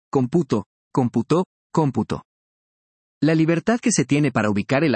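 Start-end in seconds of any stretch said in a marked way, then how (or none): 0.1-0.7 "computo" with weight on the middle syllable pu; stress on pu. Shown as a demonstration. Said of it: "por el lugar donde recae el acento". 0.9-1.4 "computó" with weight on the final tó; stress on the last syllable. Shown as a demonstration. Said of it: "por el lugar donde recae el acento". 1.7-2.2 "cómputo" with weight on the opening cóm; stress on the first syllable. Shown as a demonstration. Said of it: "por el lugar donde recae el acento".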